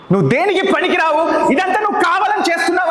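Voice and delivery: high-pitched scolding shout; mother's yelling. Retold or imitated. Imitating mother berating the child, high angry yelling. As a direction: yell it high, reliving the scolding. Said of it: "అరుస్తూ ఉండేది".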